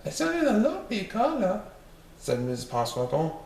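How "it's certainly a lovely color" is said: high lady's voice; mother's voice. Acted. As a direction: praise the colour as the mother. Missus Popsnorkel speaks.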